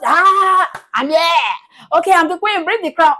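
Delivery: high, loud, hammed-up queen announcing herself; mock self-crowned queen. Co-host mocking someone who claims an unearned crown, hammed-up queen.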